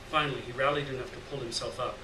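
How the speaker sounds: usual speaking voice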